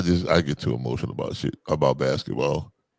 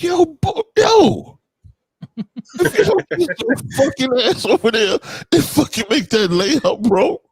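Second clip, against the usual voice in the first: much higher and louder, a full-throated shout; furious coach yell; 4 dB louder, slower than usual. Retold or imitated. Coach yelling at a boy who quit on layups, furious shout. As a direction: scream at the kid to make that layup.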